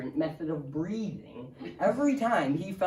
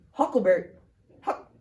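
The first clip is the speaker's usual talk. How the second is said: ordinary talk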